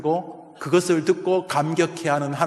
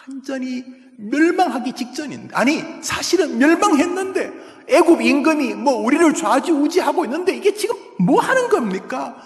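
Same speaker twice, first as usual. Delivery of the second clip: high, raised-voice complaint to God; resentful outcry; 7 semitones higher, 4 dB louder than usual. Acted. Habakkuk complaining before God about a fallen nation, resentful outcry. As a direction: demand to know what is happening, exasperated. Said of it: "하나님 앞에 막 원망, 절규".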